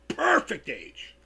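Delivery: slow, stretched, put-on voice; odd drawn-out voice. Real, not acted. Streamer stretching a phrase in a funny voice. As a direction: drag out perfect in a strange voice. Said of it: "I don't know why I said it like that".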